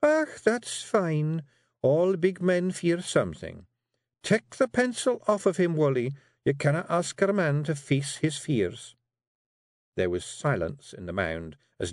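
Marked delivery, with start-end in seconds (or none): 0.0-8.9 high, sly Scots teasing; artful Jeanie. Acted. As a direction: bait him with mock surprise.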